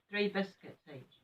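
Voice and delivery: usual speaking voice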